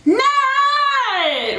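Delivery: belted out loud and high. Shown as a demonstration. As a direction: belt it high and hard.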